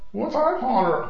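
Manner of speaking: high-pitched puzzled question; confused office worker. Acted. Confused reply in computer guy sketch.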